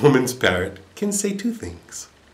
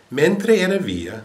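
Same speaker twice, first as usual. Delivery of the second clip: ordinary delivery, nothing marked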